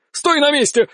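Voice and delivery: high-pitched angry command; exasperated shout. A narrator's reading. Swordsman missing a dodging opponent, exasperated shout. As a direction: order him to stand still.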